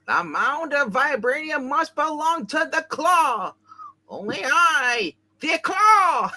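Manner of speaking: villain voice. Acted. Claw villain orders.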